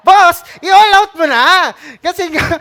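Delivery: big hyped-up shout of encouragement. Acted. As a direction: call it out like a cheer. Urging full baptism, shouting.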